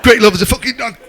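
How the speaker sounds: high, loud mock alarm; joining the shouting. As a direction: call it out as the dad catching the habit. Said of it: "Even I were at it then".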